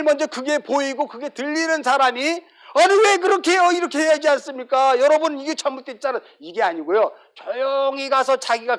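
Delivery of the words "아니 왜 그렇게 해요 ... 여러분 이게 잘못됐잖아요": high, loud, indignant protest; indignant complaint. Retold or imitated. Church member loudly objecting to how things are done, indignant.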